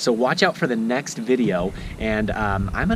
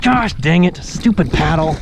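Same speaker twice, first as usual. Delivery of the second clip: mock frustration; 7 semitones higher, 4 dB louder than usual. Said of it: "I'm like".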